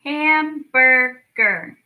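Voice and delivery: "Hamburger" stretched slowly, syllable by syllable; drawn out slowly. Shown as a demonstration. Teacher modelling the word for kids, slow and stretched. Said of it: "Let's try to clap that one out".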